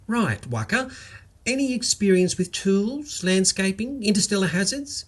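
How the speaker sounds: a lightly voiced office manager; brisk interviewer. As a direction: run through the checklist briskly. Tracy's interview questions.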